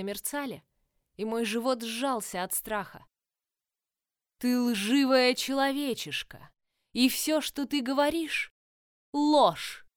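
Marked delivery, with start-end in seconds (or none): 4.4-9.9 loud, high, furious queen. A narrator's reading. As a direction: rage at the human girl. Enraged queen accuses.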